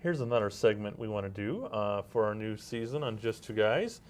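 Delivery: normal talking voice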